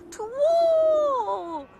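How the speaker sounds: high shouted owl hoot. Acted. Owl hooting the news across the farm.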